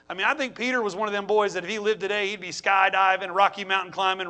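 normal talking voice

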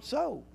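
so lifted well above the line, kept soft; stress on so. Shown as a demonstration. Preacher lingers on "so" for the congregation, high and soft. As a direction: give so a long, high lift. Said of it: "I always like to emphasize the word".

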